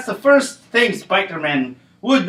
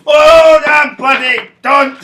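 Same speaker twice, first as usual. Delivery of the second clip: laughing shout; 7 semitones higher, 7 dB louder, slower than usual. Acted.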